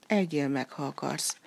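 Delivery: usual speaking voice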